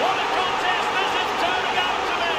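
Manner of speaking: building goal call. Acted. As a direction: build to it, then belt the equaliser. Commentator calls equalising goal.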